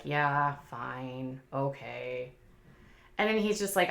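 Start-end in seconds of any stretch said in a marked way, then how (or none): 0.0-2.3 mock defeated husband caving; resigned giving in. Husband grudgingly caving to his wife's request, in a lower, softer voice.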